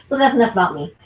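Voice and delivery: ordinary talk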